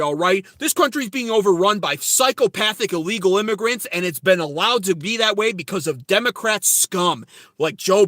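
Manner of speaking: loud, heated political tirade; going off on a rant. Shown as a demonstration. Streamer acts out ranting to a grocery store cashier, loud and heated. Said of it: "I'll just go off like that".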